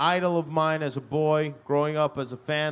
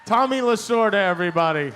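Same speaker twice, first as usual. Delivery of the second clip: big higher, louder introduction to the crowd; announcing; 5 semitones higher, 4 dB louder than usual. Real, not acted. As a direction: call his name out like a ring announcer.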